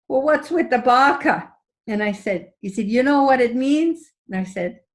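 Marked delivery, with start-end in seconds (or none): 0.1-1.5 louder, higher mock Russian accent; thick Russian accent. Cab driver asks passenger about the word, imitated Russian accent. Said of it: "with a really thick Russian accent". 2.9-4.1 louder, higher imitated Russian accent; thick Russian accent. Cab driver quizzes passenger on the word, imitated Russian accent. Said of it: "with a really thick Russian accent".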